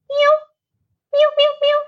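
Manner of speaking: very high head-voice cat meows; high kitty meow. Shown as a demonstration. Music teacher shows children a kitty's head voice, high meowing. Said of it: "That's a head voice one".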